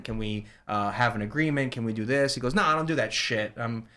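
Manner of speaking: usual speaking voice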